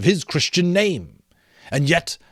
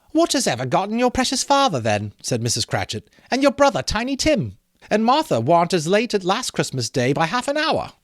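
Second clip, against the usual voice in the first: much higher, bustling mother's voice; fretful mother; 6 semitones higher than usual. Acted. Mrs Cratchit asks her children where father is, higher and fussing. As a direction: fuss like a busy mother waiting for her family.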